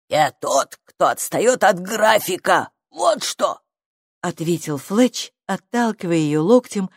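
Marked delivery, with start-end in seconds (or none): 0.1-3.6 grumpy creature. A narrator's reading. Busy creature snaps at girl.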